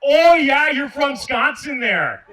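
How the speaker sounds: big drawn-out Midwestern holler; blasting. Acted. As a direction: blast it at the crowd, then draw out the place name. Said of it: "am I blasting people".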